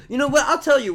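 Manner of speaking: high, loud sudden challenge; loud burst. Real, not acted. Vlogger erupts at his own anger, loud. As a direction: snap it out. Angry outburst.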